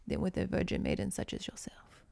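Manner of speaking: breathy menacing whisper; villain's whisper. Acted. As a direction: lean in and whisper it like a soap villain. Villain seducing maiden, telenovela.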